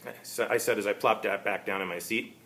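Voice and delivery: usual speaking voice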